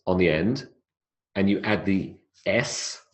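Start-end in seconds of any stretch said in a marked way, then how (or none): none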